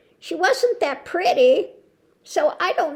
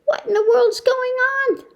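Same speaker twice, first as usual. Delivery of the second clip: high-pitched incredulous question; a mock cry of bewilderment; exasperated disbelief; 9 semitones higher than usual. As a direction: sound baffled with the pitch way up. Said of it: "And I'm going".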